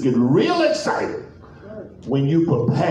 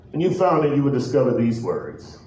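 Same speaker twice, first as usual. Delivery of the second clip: ordinary talk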